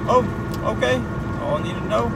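ordinary delivery

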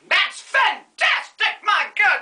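high, slow gushing praise; hammed-up delight; mock gushing. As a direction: gush over her like a fawning diva. Over-the-top admiring exclamation.

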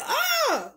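high-pitched imitated cat yelp; cat's mock yelp. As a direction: cry out like an offended cat. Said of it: "But she said".

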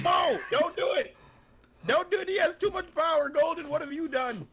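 high dramatic mock plea; mock shout. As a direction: ham it up like it's doom. Dramatic plea not to be moved.

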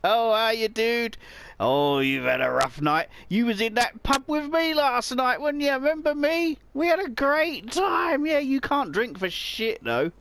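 high, loud, matey banter; jovial pub mate. Pretend. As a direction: hail an old drinking buddy. Greets passer-by as pub mate.

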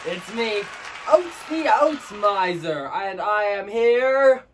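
high, loud, slow hammy salesman character; hammy Oats Miser. Acted. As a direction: announce yourself like a grand cartoon miser.

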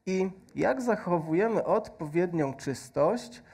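ordinary talk

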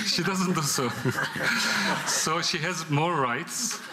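laughing it out, voice lifted high; chuckling through the joke about his wife. Real, not acted. Director laughing as he admits his wife argues back.

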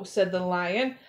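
ordinary delivery, nothing marked

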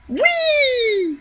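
high gleeful whee; playful flying squeal. Pretend. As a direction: squeal as you fly. Character launched into air.

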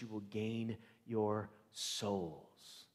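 usual speaking voice

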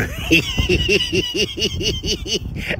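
high squealing laugh; shrieking laugh. Real, not acted. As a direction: burst into a shrill laugh. After punchline.

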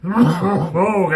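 ordinary talk

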